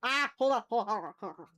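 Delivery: usual speaking voice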